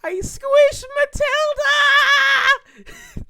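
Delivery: hammed-up, very high wailing confession; mock bawling. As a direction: wail it out like a bawling kid, exaggerated. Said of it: "I'm, like, bawling my eyes out".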